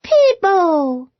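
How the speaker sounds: put-on high, delighted baby voice; baby reaching for Peabody; toddler calling. A narrator's reading. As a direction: call out like a toddler wanting a hug.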